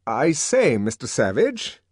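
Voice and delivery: propitiating. A narrator's reading. Deputy sub-bursar coaxing the savage, propitiating. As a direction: soothe him into giving way. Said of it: "smiling propitiatingly".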